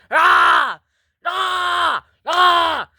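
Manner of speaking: loud, high "rah" bellows; screamed roars. Acted. Showing off who is loudest, screamed roars. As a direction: roar over and over at full voice. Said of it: "Stop screaming".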